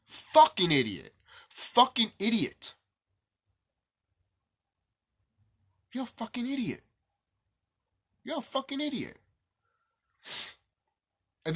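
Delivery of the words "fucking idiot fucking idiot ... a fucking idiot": mock insult. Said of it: "And I'm over here like".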